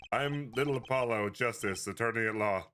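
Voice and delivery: ordinary delivery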